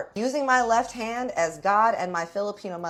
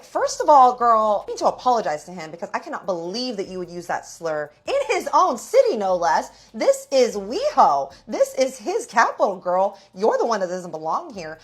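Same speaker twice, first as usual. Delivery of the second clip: loud, emphatic scolding in bursts; indignant telling-off; 4 semitones higher, 8 dB louder than usual. Retold or imitated. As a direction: re-enact laying into her, hammering each point. Acted telling-off outside after fight.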